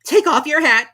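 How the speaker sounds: very high, loud royal command; a pompous king giving orders; King ordering the herald to read; King's voice. A narrator's reading. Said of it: "the king said".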